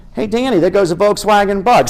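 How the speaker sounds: high, playful mom voice; a mother calling out in the car game; imitating his mom. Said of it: "She'd be like".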